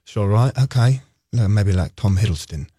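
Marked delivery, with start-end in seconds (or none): 1.3-2.7 deep and sultry. Acted. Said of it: "deep and sultry a little bit".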